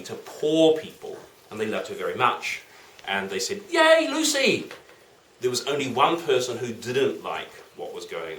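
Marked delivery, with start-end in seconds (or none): 3.7-4.7 high-pitched hooray; delighted, high cheer; cheering crowd. Acted. Crowd cheers Lucy in the story.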